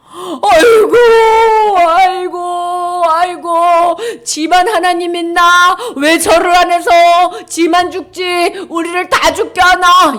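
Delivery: drawn-out wails of despair; wailing lament. Acted.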